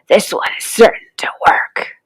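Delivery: sly villain whisper; whispered to himself. A narrator's reading. Crocodile to himself about his trick: sly whisper. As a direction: hiss the plan under your breath. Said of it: "he said to himself".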